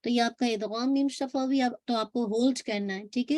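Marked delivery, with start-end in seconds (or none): none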